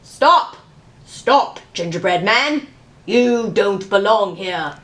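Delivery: a paper bird scolding from above; calling bird. A narrator's reading. Origami bird calls out to the gingerbread man, loud scold. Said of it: "It flapped its paper wings and called".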